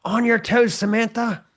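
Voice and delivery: high, loud dance-mom yell; militant yelling. Acted. Militant dance mom to Samantha, yelling out. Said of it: "the militant dance mom yelling out".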